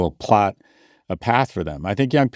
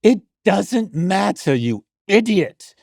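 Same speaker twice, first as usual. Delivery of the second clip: high, slow mock-British woman scolding; hammed-up British lady, drawn out and high; Joanna Coles; 12 semitones higher, much slower than usual. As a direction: scold in an exaggerated British falsetto. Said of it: "my woman's British impersonation".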